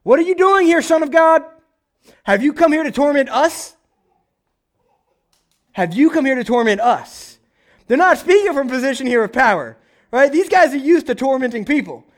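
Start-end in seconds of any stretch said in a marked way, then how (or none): 0.0-7.4 crying out. Retold or imitated. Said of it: "they cry out".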